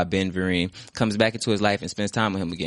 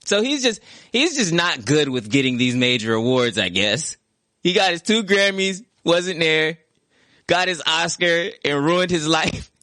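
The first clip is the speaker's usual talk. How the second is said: mocking voice; 9 semitones higher, slower than usual. Mocking aside about himself.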